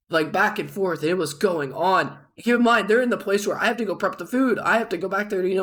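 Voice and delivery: plain reading voice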